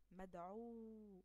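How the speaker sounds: مدعو said very slowly, each sound stretched; slow teacher demo of مدعو; drawn-out model word. Shown as a demonstration. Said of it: "مدعو هنا".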